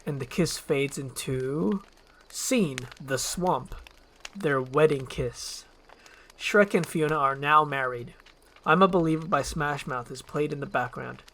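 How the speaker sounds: usual speaking voice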